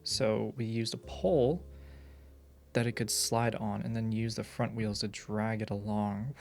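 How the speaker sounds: normal talking voice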